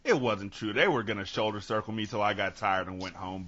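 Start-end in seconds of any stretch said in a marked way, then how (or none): none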